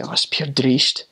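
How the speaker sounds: lightly put-on, slow Scottish brogue; a hint of broad Scottish; Scottish accent. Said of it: "they say".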